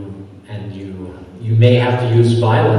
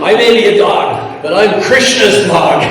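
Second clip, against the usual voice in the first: high, louder comeback through laughter; laughing retort; 8 semitones higher, 6 dB louder than usual. Retold or imitated. Speaker answers the man's insult, laughing proud retort. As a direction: toss back the retort half-laughing.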